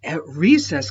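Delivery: ordinary delivery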